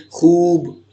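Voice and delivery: خوب with the oo stretched out long, slowly; long drawn vowel. Shown as a demonstration. Teacher showing students a long oo, stretched slowly. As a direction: draw out the oo in خوب, khooob. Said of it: "اس کو ہم کھینچ کر پڑھتے ہیں".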